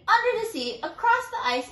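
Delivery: ordinary delivery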